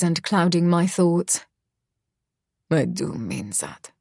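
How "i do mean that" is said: low, soft growl; quietly growled threat. Acted. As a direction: lower and softer, holding back menace. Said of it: "he growls quietly".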